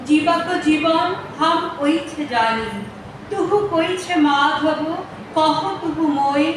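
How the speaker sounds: ordinary delivery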